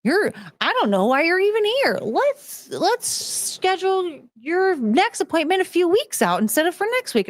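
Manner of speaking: high, slow, soothing therapist impression; mock a dismissive, chirpy therapist; hammed-up cheerful shrink voice. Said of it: "the fucking therapist was like".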